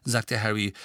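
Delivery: ordinary delivery, nothing marked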